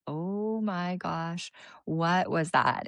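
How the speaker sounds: slow stunned awe. Retold or imitated. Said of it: "I was just like".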